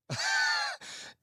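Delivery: sudden high burst of laughter. Real, not acted. Realizes audience can't hear music.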